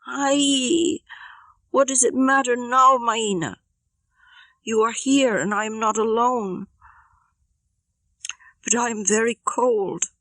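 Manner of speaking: put-on high, thin child's voice; a girl in the cold night reassuring Maina; cold young girl. Lakwit answers Maina, cold.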